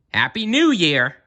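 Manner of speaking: loud, high cheerful hail; shouting greeting. Acted. Zebra skating up, shouting a greeting to all. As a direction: call out as you skate up. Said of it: "he shouted".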